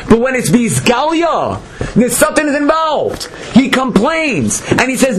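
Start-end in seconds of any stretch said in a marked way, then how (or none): none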